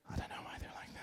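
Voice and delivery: breathy whispered aside. Shown as a demonstration. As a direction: drop to a whisper as if confiding. Voice demo ending in whisper.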